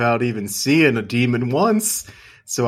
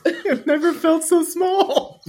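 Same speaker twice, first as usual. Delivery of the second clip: high, slow laughing whine; slower than usual. Real, not acted. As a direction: squeak it out while laughing. Host laughing through the joke.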